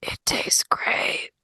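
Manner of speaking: slow, drawn-out whisper. Acted. Wythe whispers to Isadora beside her at the meal, whisper. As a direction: whisper it like a secret to a seatmate. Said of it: "sit next to Isadora and whisper".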